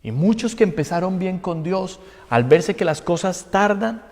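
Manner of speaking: usual speaking voice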